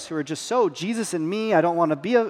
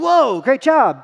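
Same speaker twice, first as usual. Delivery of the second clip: slow, louder, overdone cheer; mock praise; 5 semitones higher, 5 dB louder, much slower than usual. Dad cheering his son after a loss, hammed-up praise. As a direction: lay the praise on thick.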